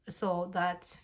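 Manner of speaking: usual speaking voice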